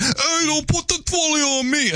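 a high put-on Long Island accent. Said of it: "I can't do a Long Island accent".

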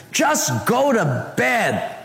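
very slow, drawn-out, high exasperated order; weary annoyance. Retold or imitated. Wayne under the sheets telling roommate off, slow exasperated drawl. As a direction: stretch it out, fed up and sleepy.

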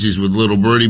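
normal talking voice

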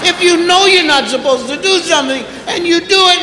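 normal talking voice

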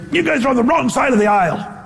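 high, scornful mock jeer; a hammed-up contemptuous rant; mock insult. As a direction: play a preacher sneering at sinners. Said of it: "he said".

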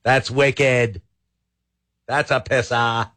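lightly done Boston accent; attempted Boston accent. Shown as a demonstration. Radio host playing with Boston slang in a Boston accent. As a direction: try a Boston turn of phrase, accent and all. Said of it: "say that in a Boston accent".

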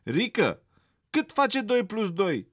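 teacher quizzing the rabbit again; stern teacher. A narrator's reading. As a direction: press the pupil for the answer. Teacher repeats question.

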